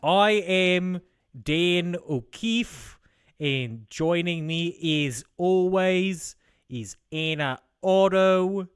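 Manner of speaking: hammed-up Australian podcast welcome; questionable Australian accent. Host greets folks and co-host Anna, questionable Australian accent. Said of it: "doing a questionable Australian accent".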